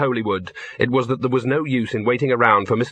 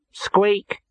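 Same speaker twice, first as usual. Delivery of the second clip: mouse squeak; 8 semitones higher than usual. Acted. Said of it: "it commented".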